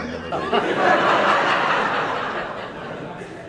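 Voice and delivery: long wheezing burst of laughter; breathless high laugh; big laugh. Real, not acted. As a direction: crack up helplessly at the memory. Laughing at his own story.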